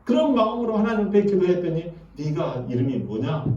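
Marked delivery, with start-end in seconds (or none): 2.2-3.4 low, soft voice of God asking; deep God voice. Retold or imitated. God gently questioning Jacob, low and soft. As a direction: speak low and quiet as God.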